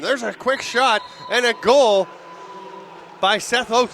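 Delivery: loud, high, stretched-out goal cry; real play-by-play excitement; excited goal call. Real, not acted. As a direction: burst into the call as it scores. Calling a goal live.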